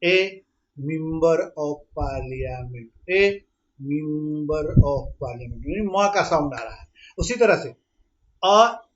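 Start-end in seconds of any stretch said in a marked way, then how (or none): 0.0-2.9 very slow, each word stretched; slow motion. Shown as a demonstration. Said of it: "देखिए slow motion में बोल के देखिए". 3.1-5.7 very slow, each word stretched; slow motion. Shown as a demonstration. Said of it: "देखिए slow motion में बोल के देखिए".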